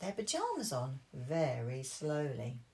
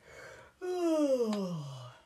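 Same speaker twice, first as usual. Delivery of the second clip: big loud drawn-out yawn; falling theatrical yawn; 7 semitones higher, 6 dB louder than usual. Acted. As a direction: yawn loudly like a tired dad. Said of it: "yawned Daddy Pig very loudly".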